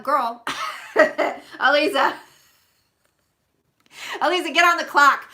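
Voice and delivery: loud, laughing mock order; teasing call-out. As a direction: jokingly call her out. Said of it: "No, I'm kidding".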